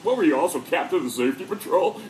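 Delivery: mock him like a snobby roommate; mocking jab. Mocking roommate sneers at award.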